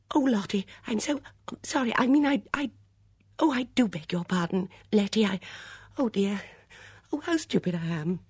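high, gasping, stammered apology; flustered apology. A narrator's reading. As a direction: fluster and break off between phrases.

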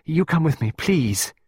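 put-on high, needy child's plea; anxious little entreaty; pleading. A narrator's reading. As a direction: plead not to be left alone. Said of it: "the child pleaded".

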